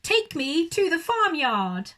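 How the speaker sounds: high, loud command; bossy urgent order; loud demand. Acted. Owl ordering pig.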